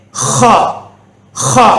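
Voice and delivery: ஹா as a rasped 'kha'; ஹா begun with a scraping kh; throat-clearing kh. Shown as a demonstration. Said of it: "என்று காரி உச்சரிக்க வேண்டும்".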